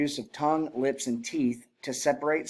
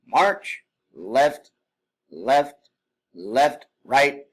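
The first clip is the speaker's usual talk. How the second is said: calling cadence; 2 semitones higher, 6 dB louder, much slower than usual. Shown as a demonstration. Instructor calling marching cadence loudly. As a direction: bark the march and the steps. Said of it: "it's like calling left and right".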